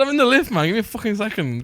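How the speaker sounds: high-pitched, impatient phone reply; mock exasperation. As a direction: plead for patience, hammed-up and high. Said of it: "I said".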